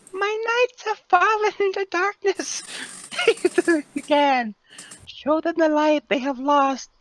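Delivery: high, distant dragon messenger voice; voice changer. Acted. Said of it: "I do this in a modified voice".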